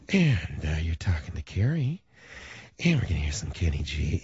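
seduce the late-night listener; sexy late-night DJ. Retold or imitated. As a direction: female night jock cooing into the mic. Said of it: "she used to talk like this".

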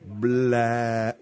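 low, hugely drawn-out word. Shown as a demonstration. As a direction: drag the vowel as long as possible.

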